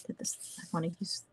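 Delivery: soft, fast whisper to herself; a quick private whisper before restarting the sentence; whispered aside. Real, not acted. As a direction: mutter it under the breath, quickly. Muttered aside while fixing a slip.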